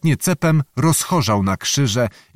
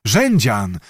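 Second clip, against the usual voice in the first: ordinary delivery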